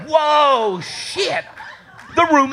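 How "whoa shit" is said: normal talking voice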